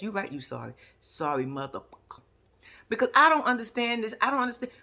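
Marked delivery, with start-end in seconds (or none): 0.0-2.2 low, soft quoted line. Retold or imitated. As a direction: drop it low and quiet as a quote. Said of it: "[name] said it best".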